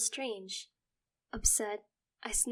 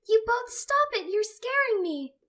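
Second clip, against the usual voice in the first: frightened plea; 11 dB louder than usual. Acted. Sister pleads, frightened.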